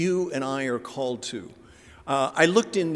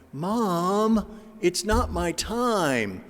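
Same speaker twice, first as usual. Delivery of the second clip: higher, slow, patient refusal to his mother; gentle son's reply; 5 semitones higher, much slower than usual. Acted. Jesus answering his mother at the wedding, slow and patient. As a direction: gently put mom off.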